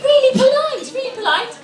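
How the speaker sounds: high-pitched gushing waitress; imitating an admiring waitress; polite waitress. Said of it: "and she said".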